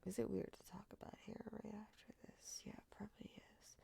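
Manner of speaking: faint whisper; almost silent whispered aside; whispering a question to herself. Real, not acted. Whispered self-doubt aside.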